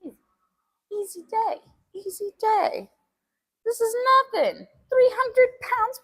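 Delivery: high, cocky self-pep talk; hyping myself up. Real, not acted. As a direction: pump yourself up, bravado. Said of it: "only saying that to hype myself up".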